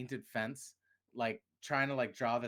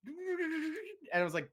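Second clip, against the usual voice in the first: laughing; much slower than usual. Retold or imitated. Mocking own brain.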